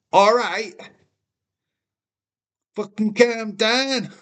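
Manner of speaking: high, exasperated calm down; mock outburst. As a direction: pretend to lose it at chat. Snapping at chat teasing.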